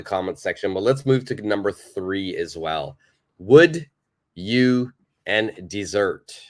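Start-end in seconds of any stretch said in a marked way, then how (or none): 3.4-6.4 slow, punched Would, then drawn out; slow gap-fill read. Shown as a demonstration. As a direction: read the blank sentence slowly for students. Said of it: "fill in the blank".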